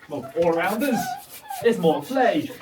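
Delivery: ordinary talk, nothing marked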